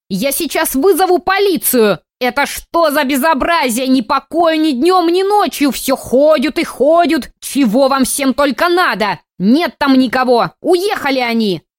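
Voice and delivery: put-on high, loud, shrill-tempered old lady; scolding old woman. A narrator's reading. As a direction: scold like an angry old granny. Old neighbour scolding loudly.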